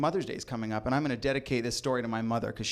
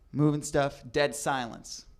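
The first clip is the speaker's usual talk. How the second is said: ordinary delivery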